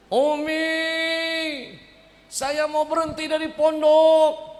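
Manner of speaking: high, loud, slow whiny fake sob; fake crying. Son fake-crying to his mother, high and loud. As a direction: whine like a crying kid. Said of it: "saya menangis, acting".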